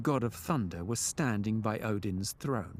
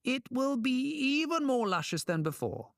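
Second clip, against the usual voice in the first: very high, gushing promise; gushing trickster; 13 semitones higher than usual. A narrator's reading. Trickster assures king about the wife's hair, gushing.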